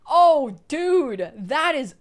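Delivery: loud, high, delighted yell at the fan art; thrilled shout. Real, not acted. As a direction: shout with pure joy, slowly.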